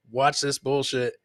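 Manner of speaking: ordinary talk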